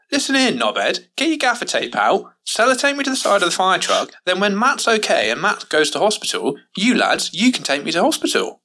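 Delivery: high-pitched, worked-up ordering about; angry rant. Retold or imitated. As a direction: re-enact a heated demand, pitched well up. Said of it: "I got really angry".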